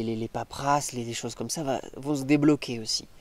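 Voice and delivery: ordinary talk, nothing marked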